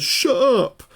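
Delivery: high, loud squawked retort; mock the man's high-pitched yell; impression of irate driver. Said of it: "exactly that voice as well".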